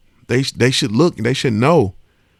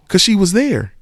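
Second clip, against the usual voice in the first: ordinary delivery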